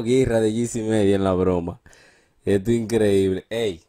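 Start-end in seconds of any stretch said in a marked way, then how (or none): none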